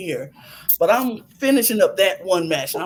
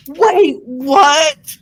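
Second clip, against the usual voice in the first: high, loud, stunned double take; shocked wait what; 5 semitones higher, 6 dB louder than usual. Retold or imitated. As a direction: snap back in disbelief. Shocked at the referee twist.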